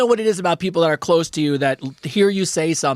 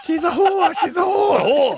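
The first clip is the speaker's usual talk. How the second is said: high, shouted parrot impression through laughter; mock parrot squawk; 12 semitones higher, slower than usual. Co-host replaying what the parrot said, laughing mock squawk. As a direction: squawk it out high, drawing out the repeat.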